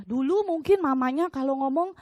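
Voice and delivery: usual speaking voice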